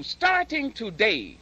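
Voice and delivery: normal talking voice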